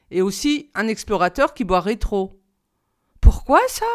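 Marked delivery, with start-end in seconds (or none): 3.2-3.8 pourquoi ça lancé très haut et fort; demande-le vivement, très haut, avec étonnement; question étonnée. A narrator's reading. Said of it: "fit le petit prince". (le petit prince, étonné, questionne le géographe)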